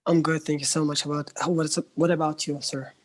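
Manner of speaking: normal talking voice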